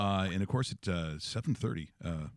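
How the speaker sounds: just woke up, low energy; sleepy voice. Real, not acted. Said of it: "we're gonna hear sleepy voice".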